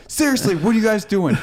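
high, imitated frantic questioning; mock exasperated coworker; exasperated demand. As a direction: confront them, pitch jumping up. Imitates freaking-out coworker confronting pranksters.

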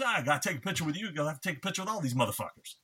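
a hammed-up celebrity brush-off, quick; imitating Samuel L. Jackson. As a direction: rattle it off like a brush-off. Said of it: "And he goes, he goes".

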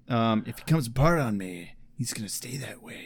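low and menacing, fading to a whisper; low menacing reply. Retold or imitated. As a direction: growl it low, then whisper. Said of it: "quoting Angel's reply".

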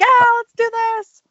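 loud, high imitation of an excited friend; excited yell. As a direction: draw out a hyped-up, high-pitched yell. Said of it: "yells, like, when he's really excited".

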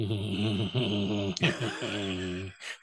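low, drawn-out creepy drone; eerie sleepwalker noise. Retold or imitated. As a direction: imitate a possessed sleepwalker's noise. Imitates sleepwalking daughter's eerie noise.

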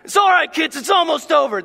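mom voice. Retold or imitated. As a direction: reassure the kids over the noise. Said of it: "mom would be like".

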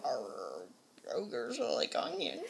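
slow, soft mock Scottish; quiet, slow, shaky Scottish accent; Scottish accent attempt. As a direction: slowly drawl it in a put-on Scottish accent. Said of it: "in your best Scottish accent".

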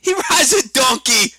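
put-on loud, high, drawn-out kid voice; slow, high child's shout; imitating young daughter. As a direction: blurt it proudly like a preschooler. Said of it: "She's like".

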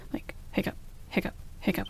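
breathy soft hiccup, hiccup, hiccup in a quick beat; rhythmic hiccup imitation. Describing the baby's hiccups to partner, whispered rhythmic mimic. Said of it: "this rhythmic, it was coming pretty quickly".

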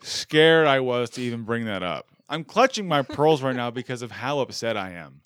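loud, hammed-up indignation; mock outrage. Host scolding guest for bringing up his temples, mock outrage. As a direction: draw out the offence theatrically.

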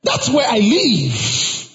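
high-pitched, drawn-out sermon delivery; preaching. Real, not acted. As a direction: preach it with full conviction to the congregation. Preaches to congregation.

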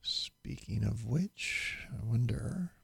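soft whispered aside; whispering. Real, not acted. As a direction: whisper it to yourself. Muttered aside after a long pause.

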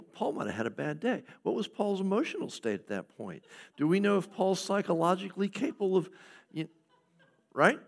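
a hammed-up skeptic picking Scripture apart; mock skeptic. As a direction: needle the text with doubtful questions. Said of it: "a series of repeating".

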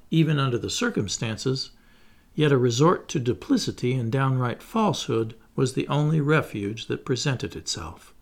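plain reading voice

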